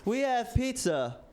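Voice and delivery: high, slow, overly formal greeting; stiff nervous host. Retold or imitated. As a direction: play an awkward robot hostess welcoming a guest. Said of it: "and she was like".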